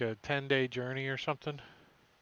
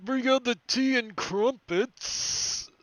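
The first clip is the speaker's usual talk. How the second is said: posh sailor voice; 8 semitones higher, 6 dB louder than usual. Acted. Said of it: "they're like".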